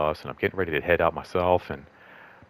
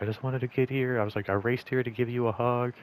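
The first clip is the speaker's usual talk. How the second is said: higher-pitched retelling of a supervisor's heartfelt words; imitating supervisor; 6 semitones higher than usual. Supervisor explains racing over for a hug, lightly imitated in higher pitch. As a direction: lightly voice an earnest colleague, pitched up. Voicing the supervisor who hugged her.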